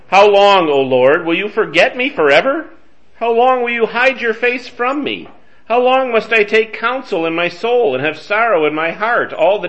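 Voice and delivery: loud, high, pleading cry at God; angry lament. Acted. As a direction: yell the psalm up at heaven. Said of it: "You can hear the anger in David's voice".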